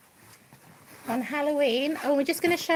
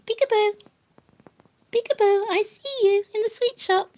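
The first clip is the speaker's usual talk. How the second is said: playful peekaboo; 6 semitones higher, 4 dB louder than usual. Real, not acted. Playing peekaboo with pet.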